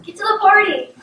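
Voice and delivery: impatient interruption. Real, not acted. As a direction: butt in, hurrying the storyteller along. Child impatient with the story.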